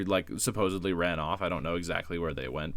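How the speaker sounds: normal talking voice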